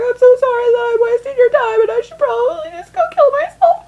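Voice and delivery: imitating her teenage self sobbing; mock sobbing wail. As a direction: wail it like a breakdown in the ambulance. Retold crying apology.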